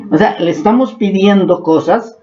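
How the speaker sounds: usual speaking voice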